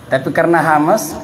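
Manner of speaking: usual speaking voice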